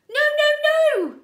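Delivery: put-on very high, loud baby shout. A narrator's reading. Sunny shouting at Count Olaf, very high and loud. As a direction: shriek it at the villain. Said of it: "Sunny shouted".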